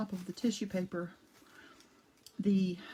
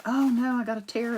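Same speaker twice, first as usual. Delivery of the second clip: dismayed oh no; 3 semitones higher, 7 dB louder than usual. Real, not acted. Spots a tear in the paper.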